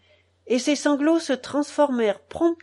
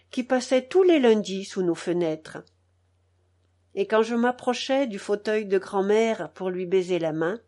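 usual speaking voice

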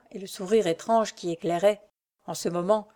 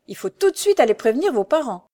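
raised, louder, eager urging; urgent young grinder; 5 semitones higher, 6 dB louder than usual. A narrator's reading. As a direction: press her to act, lightly voiced. Pierre urges action.

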